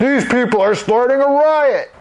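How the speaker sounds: high-pitched, agitated holler; irate doorman denouncing the crowd; outraged accusation. Acted. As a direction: cry out in alarm to the mayor. Fat man accuses crowd amid clamour.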